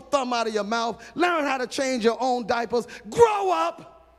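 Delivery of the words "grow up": sudden high shout; shouted rebuke. Real, not acted. Preacher to backsliders, a sharp shouted rebuke. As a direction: bark it at the congregation.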